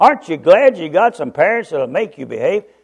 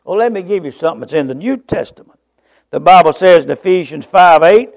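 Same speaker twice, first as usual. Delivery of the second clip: ordinary talk, nothing marked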